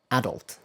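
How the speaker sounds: adult stressed on the first syllable; British stress. Shown as a demonstration. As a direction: say adult as "AD-ult". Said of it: "I said the British pronunciation first".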